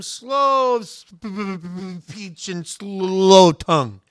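loud, slow, stuck on "speech" and "slow"; acted stutter. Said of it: "Most believe you had a st-, st-, stutter".

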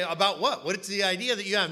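loud, acted objection; mock protest. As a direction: push back loudly as the baffled recruit. Acted puzzled pushback from congregant.

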